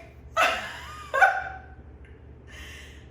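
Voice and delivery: high-pitched hysterical laughter; high squealing laugh. Real, not acted. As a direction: cackle up high, helpless. Cracking up.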